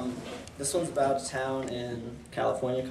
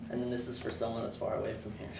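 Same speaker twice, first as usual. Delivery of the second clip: ordinary delivery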